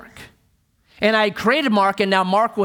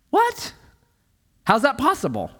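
mock surprise; 7 semitones higher than usual. Listener's imagined objection.

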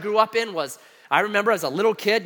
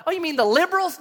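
questioning mom; 10 semitones higher, 3 dB louder than usual. Retold or imitated. Mom on phone guessing who, high questioning voice.

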